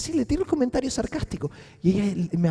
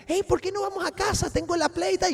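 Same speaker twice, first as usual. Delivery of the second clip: put-on higher, sing-song talk to a little kid; childlike voice; 9 semitones higher, 4 dB quieter than usual. Coaxing the girl to come home, higher childlike voice. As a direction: coax her like a small child. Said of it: "le empecé a hablar como una niña pequeña".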